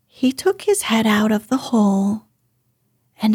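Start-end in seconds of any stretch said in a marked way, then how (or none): none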